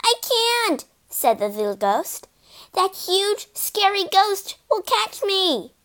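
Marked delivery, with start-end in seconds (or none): 0.0-0.8 high, anxious refusal; scared little ghost; frightened. A narrator's reading. 2.7-5.7 high, fearful little voice; scared ghost's anxious explanation; frightened. A narrator's reading.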